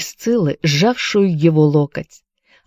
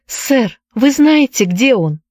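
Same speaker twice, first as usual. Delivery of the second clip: high, urgent entreaty; pleading; 7 semitones higher, slower than usual. A narrator's reading. Anne begs the tavern keeper about Jared, pleading. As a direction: beg for news. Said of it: "умоляюще заглянула ему в лицо".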